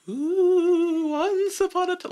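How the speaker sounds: high, eerie ghost wail; spooky ghost storyteller voice; ghostly voice. Pretend. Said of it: "a real ghostly voice".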